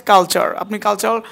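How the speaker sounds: ordinary talk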